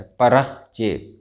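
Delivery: परख्चे slow, खे sounded clearly; परख्चे, धीमा और साफ़ उच्चारण; correct pronunciation. Shown as a demonstration. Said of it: "इसका सही तलफ़ुज़ है".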